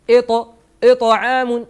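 "إط، إطعام" with the ط bounced; qalqala bounce. Shown as a demonstration. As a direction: rebound off the ط in "إطعام". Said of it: "اهتزاز في المخرج".

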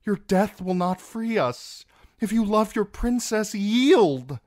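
high, loud plea; calling out. A narrator's reading. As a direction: beg him urgently to surrender. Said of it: "Arianne might have called out".

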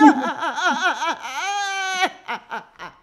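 high, loud helpless laughter; real belly laugh; loud laughing fit. Real, not acted. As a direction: crack up completely. Bursting out laughing at joke.

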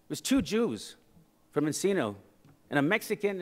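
ordinary talk, nothing marked

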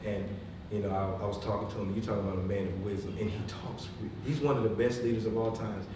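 ordinary delivery

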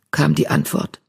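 usual speaking voice

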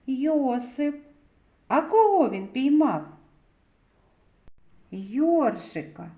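ordinary talk

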